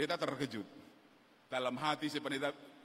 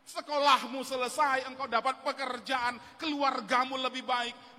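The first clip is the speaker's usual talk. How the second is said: loud, urgent sermon appeal; preaching at full force; 7 semitones higher, 5 dB louder than usual. Acted. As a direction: press the young listeners to choose God. Preaching to the young people. (seruan khotbah yang lantang dan mendesak)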